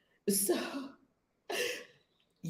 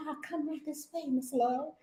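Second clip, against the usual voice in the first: much softer, sweetly polite; lovely greeting; 3 semitones higher, 9 dB quieter than usual. Retold or imitated. Lounge staff welcoming Miss Love, soft and polite. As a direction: usher her in gently. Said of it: "they treat you lovely".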